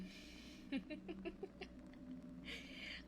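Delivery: quiet, airy laugh under the breath; a satisfied little laugh, barely voiced; breathy soft chuckle. Pleased at the doctor's attention.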